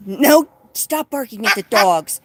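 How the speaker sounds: loud, firm no to the bird; scolding a noisy cockatoo loudly. Real, not acted. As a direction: tell the bird off sharply.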